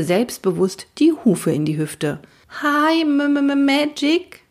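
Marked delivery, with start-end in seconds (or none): 2.6-4.4 slow, high, stammering greeting; timid boy stuttering the name; nervous stutter. Acted. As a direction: stammer it out, scared stiff. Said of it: "stotterte Fred".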